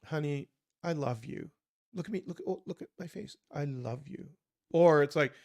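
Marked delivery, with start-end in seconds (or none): 0.0-4.3 tender to wife. Retold or imitated. Husband gazing at his wife, tender and soft. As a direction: lean in and mean every word. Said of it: "there's a very different tone of that".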